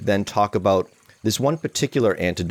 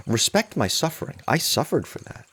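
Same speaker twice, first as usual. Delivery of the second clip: mock-wounded pride, pitched up; hammed-up tortured-artist indignation; self-important martyr; 6 semitones higher than usual. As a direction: play up the precious sufferer, without raising volume. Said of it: "We can actually romanticize suffering".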